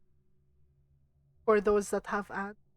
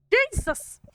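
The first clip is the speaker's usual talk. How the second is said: startled; 13 semitones higher, 6 dB louder than usual. Real, not acted. Jump scare while playing.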